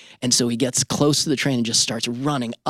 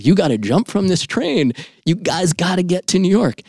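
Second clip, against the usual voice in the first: egging on; 8 semitones higher, 4 dB louder than usual. Retold or imitated. Strangers egging brother on.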